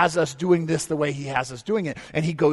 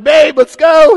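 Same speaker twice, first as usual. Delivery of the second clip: loud, high urging; husband begging wife to stop for gas; urgent plea to wife; 8 semitones higher, 14 dB louder, slower than usual. Retold or imitated. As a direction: hurry your wife along loudly.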